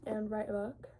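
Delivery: ordinary delivery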